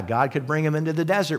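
usual speaking voice

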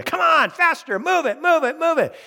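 high, urgent teacher's yell; mock yelling. Kindergarten teacher to a child tying a shoe, mock yelling. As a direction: play a teacher barking hurry-up orders. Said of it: "kindergarten teacher didn't yell at you".

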